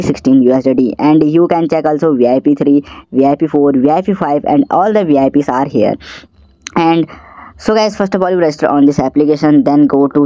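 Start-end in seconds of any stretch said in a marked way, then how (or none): none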